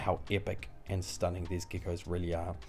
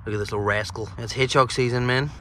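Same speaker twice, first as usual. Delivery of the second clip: goofy character voice; 3 semitones higher, 8 dB louder than usual. Acted. Voiced figure about hedgehog.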